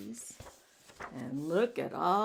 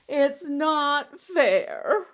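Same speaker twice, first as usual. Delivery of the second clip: slow, louder, sulky whine; pouting; 6 semitones higher, 8 dB louder, much slower than usual. Acted. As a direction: drag out the complaint like a sulking kid. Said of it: "Now he really is pouting".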